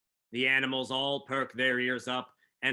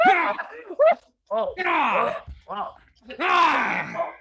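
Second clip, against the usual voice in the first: high, loud screams of terror; terrified scream; 10 dB louder, much slower than usual. Acted. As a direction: scream as the knife goes in. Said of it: "Chad lets out a terrified scream".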